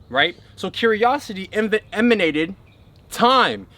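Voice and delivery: normal talking voice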